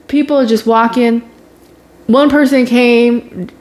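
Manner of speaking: ordinary delivery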